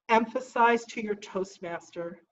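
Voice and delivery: normal talking voice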